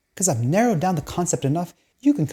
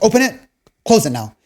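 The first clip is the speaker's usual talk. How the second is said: a mock bark, higher and louder; barked order; 7 semitones higher, 6 dB louder than usual. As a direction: bark it like an impatient dad. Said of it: "orders being barked at me".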